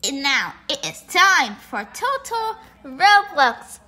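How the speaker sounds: loud, slow show announcer. Acted. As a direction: announce the show title big.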